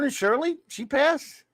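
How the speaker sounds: surprised. Real, not acted. Learning of a celebrity death.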